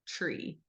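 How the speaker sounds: "tree" with a ch start, like chree, very soft. Shown as a demonstration. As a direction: let the t of "tree" become ch: chree. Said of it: "they just say".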